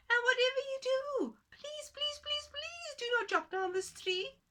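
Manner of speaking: very high, begging little voice; pleading tiny man. Acted. Tiny man begging the woman to spare his tree, very high. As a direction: squeak out a desperate plea. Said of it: "said the voice".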